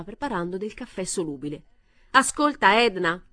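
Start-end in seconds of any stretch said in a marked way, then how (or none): 2.1-3.2 loud, high call for attention; yelling. A narrator's reading. Frustrated man yelling at silent mother-in-law in kitchen, loud. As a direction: bark her name to make her listen. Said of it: "urlò".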